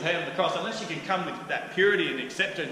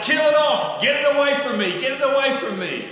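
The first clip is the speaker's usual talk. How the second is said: much higher, loud, panicked yelling; frantic shout; 12 semitones higher, 11 dB louder than usual. Retold or imitated. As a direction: shove the light away with your voice. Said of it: "they're like".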